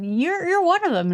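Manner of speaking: high-pitched, imitated accuser; mock accusation. Someone who saw Peter accuses him, high imitated voice.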